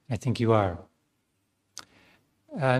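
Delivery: normal talking voice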